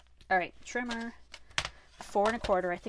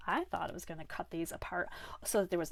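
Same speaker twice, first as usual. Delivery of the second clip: usual speaking voice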